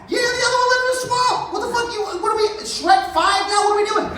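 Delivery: can't believe it's the same again; exasperated. Acted. Beowulf exasperated at another swamp.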